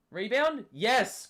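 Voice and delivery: sudden high excited shout; excited cheering. Real, not acted. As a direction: cheer it out loud. Cheering a rebound while playing.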